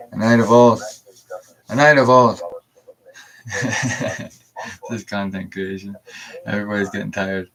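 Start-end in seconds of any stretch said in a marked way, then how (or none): 0.1-2.4 loud declaration. Real, not acted. Streamer proclaiming his own grand title, loud. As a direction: announce the title loudly, savouring it.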